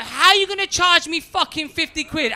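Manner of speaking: high, loud mock outrage; indignant protest. Passenger to check-in woman over the fee, loudly indignant. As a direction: raise pitch and volume in protest.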